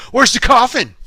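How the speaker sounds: loud, high-pitched blurt; imitating an abrupt, loud demand; blurted interruption. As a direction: butt in with a mock outburst. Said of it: "Charlie just butts in and says".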